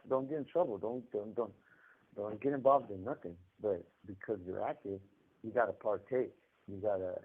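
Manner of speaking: ordinary delivery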